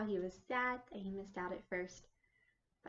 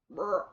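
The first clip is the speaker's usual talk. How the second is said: loud mock burp; 10 dB louder than usual. Hare burps.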